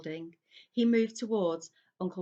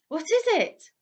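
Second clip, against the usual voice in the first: high, loud frightened gasp of a question; pulled back against the wall in fright; aunt frightened by noises in the fireplace; 11 semitones higher, 8 dB louder than usual. A narrator's reading. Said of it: "gasped Aunt Petunia".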